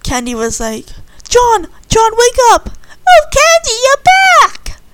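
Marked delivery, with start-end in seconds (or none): 1.3-2.7 loud high call to wake him. Acted. Candy shouting to wake sleeping John, loud and high. 3.1-4.8 high, loud joyful cry; overjoyed. Acted. John greeting Candy on her return, high and excited.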